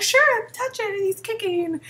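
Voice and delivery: high, mock-delighted welcome; over-eager acted invitation. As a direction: gush it high, imitating a happy mom-to-be. Voices a welcoming pregnant woman.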